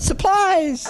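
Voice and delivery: sudden high shout jumping out; yelled punchline. Acted. Miner ambushes the boss, shrieking. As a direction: leap out and yell it high.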